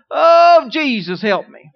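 loud, high shout of fear; frightened shouted plea; panicked cry. Retold or imitated. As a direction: yell for help like you're falling. Acting a fearful first faith step.

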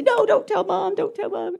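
high-pitched mock pleading; acted panicked begging. Boy begging his brother after sneaking out, high pleading. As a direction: beg like a little kid about to be told on.

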